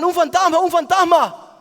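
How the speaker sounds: very high, loud repeated cry; acted frightened shouting. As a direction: imitate a panicked crew yelling out in alarm. (gritos de pánico actuados)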